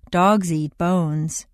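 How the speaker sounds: slow, with stress on "Dogs" and "bones"; stressing only nouns. Shown as a demonstration. Teacher modelling the exercise for learners, stressing only the nouns. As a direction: lean on "Dogs" and "bones", leave "eat" unstressed. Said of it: "go through stressing only the nouns".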